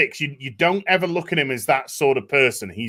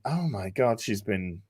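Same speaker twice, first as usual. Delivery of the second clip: lower, softer dawning horror; hushed realisation; 6 semitones lower, 6 dB quieter than usual. Retold or imitated. Viewer realising the maid has been brainwashed, hushed. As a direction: murmur it as the penny drops. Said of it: "it's just kind of like".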